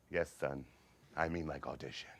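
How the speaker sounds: low, quiet, resigned deadpan; weary eye-rolling father. Acted. Father giving in to his theatrical son, wearily deadpan. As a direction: humor him with tired patience. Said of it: "My father rolled his eyes".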